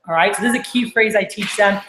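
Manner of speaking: normal talking voice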